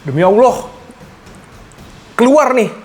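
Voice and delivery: shouting excitedly as the heat hits. Real, not acted. Kepedasan setelah minum air dingin. (heboh kepedasan)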